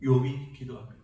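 normal talking voice

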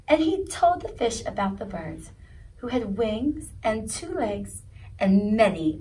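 usual speaking voice

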